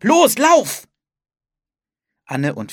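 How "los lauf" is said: very high, loud command; urgent shout. A narrator's reading. Philipp orders his sister to flee the storm, shouting. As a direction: shout at full urgency to get someone running. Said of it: "rief Philipp".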